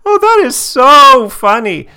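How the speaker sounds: hammed-up gushing, very high and loud; overdramatic. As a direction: imitate her overdoing it, slow and squealingly high.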